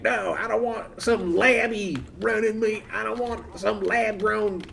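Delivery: mock complaint from the anti-lab crowd; mocking objector. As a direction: sneer it like a disgusted voter. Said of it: "that crowd of people that would be like".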